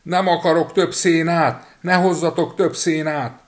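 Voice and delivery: high, loud and slow shouting; boy yelling from the cow's belly; yelling at the top of his lungs. A narrator's reading. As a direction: scream it from inside the cow. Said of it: "torka szakadtából kiabálni kezdett".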